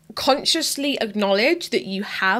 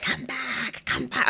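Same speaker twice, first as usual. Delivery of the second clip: half-whispered, creepy little gremlin calling; badly faked gremlin, hushed and luring; attempted gremlin voice; 6 semitones lower, 8 dB quieter, slower than usual. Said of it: "I can't do a gremlin voice".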